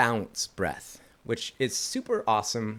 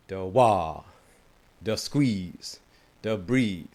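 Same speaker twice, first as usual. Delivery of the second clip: each word punched louder; emphasis on each step; 4 dB louder, much slower than usual. Shown as a demonstration. Teacher stressing the flipped emphasis, punchy. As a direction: lean into wah, squeeze and breathe. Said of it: "That has more emphasis in this rhythm".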